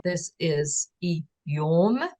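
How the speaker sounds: "Eom" stretched very slowly, rising; drawn-out Aui word. Shown as a demonstration. Teacher slowly showing how the Aui word sounds. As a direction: stretch "Eom" out so the vowel is heard. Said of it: "So then it becomes a vowel".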